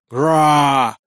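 drawn-out lizard roar; monster roar. A narrator's reading. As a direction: roar like a huge dragon. Рёв огромного ящера. (рёв огромного ящера)

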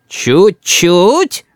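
drawn-out questioning menace, rising at the end; threatening. A narrator's reading. Boy challenges grandmother's retreat, menacing. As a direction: query it with a threatening rise. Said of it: "уточняет Рис угрожающе".

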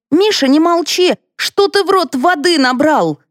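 high-pitched exasperated demand. A narrator's reading. Wife urging her silent husband to speak, exasperated.

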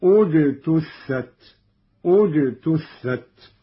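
اجتثت begun as ujtuththat, slow; اجتثت opened with a damma hamza; starting with damma. Shown as a demonstration. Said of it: "كان البدء بضم الهمز هكذا".

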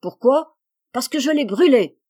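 high, loud, worked-up defiance; excited outburst. A narrator's reading. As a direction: fling it back in a temper. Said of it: "très excitée à son tour". (éclat excité, aigu et fort)

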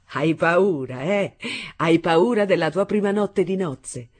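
loud, laughing taunt from a cruel husband; mocking exclamation. A narrator's reading. Father laughing loudly at his bride's fear. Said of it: "esclamò mio padre, prorompendo in una risata".

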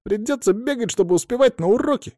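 high-pitched complaint; indignant. A narrator's reading. Fedya objects to running between lessons, indignant. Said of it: "возмутился Федя".

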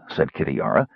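plain reading voice

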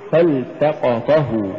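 "فالتقطه" slowly, push on ta before hu; correct فالتقطه. Shown as a demonstration. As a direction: lean lightly on the last ta of فالتقطه. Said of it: "قل".

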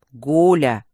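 "Гуля!" shouted loud and low, cutting her off; sharp rebuke. Acted. Rector shouts the gargoyle's name to stop her. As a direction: snap her name to shut her up.

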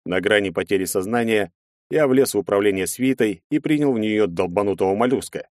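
ordinary delivery

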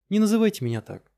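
high, indignant protest; upset protest. Acted. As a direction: push back, stung.

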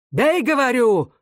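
high, drawn-out command; high-pitched, impatient yell; shouted order. A narrator's reading. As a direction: cry out the order insistently. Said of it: "крикнул Становой".

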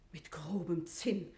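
leise, langsam geflüstert. Acted. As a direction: verbittert vor sich hin flüstern. Kammerfrau klagt über ärmliches Geschirr. (Amme flüstert empört über das Zinn)

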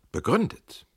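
high, loud echo of a question; ausgerufene Rückfrage. A narrator's reading. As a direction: throw the word back as an indignant question. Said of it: "rief der Italiener". (empört ausgerufene Rückfrage)

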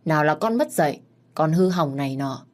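plain reading voice

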